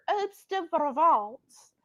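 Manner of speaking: "Herbs de Provence" drawn out in a tentative French accent; attempted French accent. Shown as a demonstration. Home cook practising her French accent on the seasoning's name, attempted French accent. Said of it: "I need to get my French accent better".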